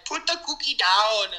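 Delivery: an amateur's Austrian action-star yell; Arnold Schwarzenegger impression. Podcaster admits her Austrian Arnold impression isn't good. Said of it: "Should I try to do my impression?".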